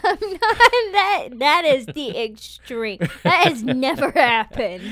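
loud, slow, indignant protest; emphatic denial. Real, not acted. Wife rebuts husband's teasing about her crying, emphatically indignant. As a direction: weigh each word in playful outrage.

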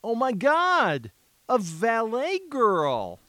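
put-on slow, drawn-out Valley Girl gush. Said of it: "you have to sound like the Valley Girl".

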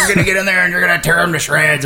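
gruff boxing trainer voice. Acted. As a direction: rile up your fighter like a movie trainer. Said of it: "it's this gruff guy".

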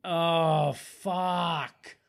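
ordinary delivery

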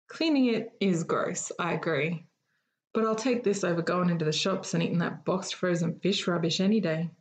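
plain reading voice

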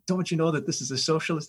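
high, alarmed scolding; hint of horrified students; freaked-out warning. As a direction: act out the panicked students. Poles freaking out.

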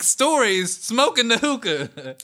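high, loud laughing mockery; cracking up while roasting them. Real, not acted. Mocking hookah smokers, laughing.

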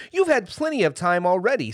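high, loud angry protest. A narrator's reading. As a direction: protest indignantly at being put off. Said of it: "said the Tin Woodman angrily".

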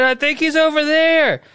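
high, drawn-out wail; mock panicked pleading. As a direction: beg for help, hamming it up. Acting panicked victim begging rescuers.

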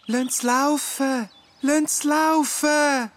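very high, slow pleading call; a second voice crying let it run. A narrator's reading. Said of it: "eine zweite Stimme ruft".

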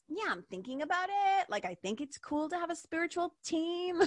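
put-on high, airy, vague valley-girl voice; airhead talk. Mocking lazy seekers to her audience in airhead voice. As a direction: toss it off like a dreamy spiritual dabbler. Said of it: "Sometimes I just like to talk like that".

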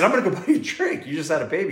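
high, fast, laughing offer to buy a drink; excited offer. Retold or imitated. Fan offering the new dad a drink, high and fast. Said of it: "I said".

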